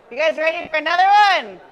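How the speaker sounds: loud, high call-out; crowd hyping. Real, not acted. Host asks crowd for another comic, hyped shout. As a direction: holler to the whole room.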